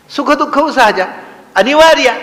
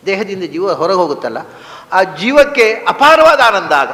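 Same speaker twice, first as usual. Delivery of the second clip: ordinary delivery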